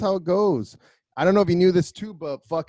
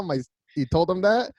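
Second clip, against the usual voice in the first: normal talking voice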